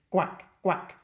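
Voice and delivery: hard G duck quacks at the back of the throat; French duck quack. Shown as a demonstration. Singing teacher demonstrates a French duck quack. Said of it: "It's more of a guac".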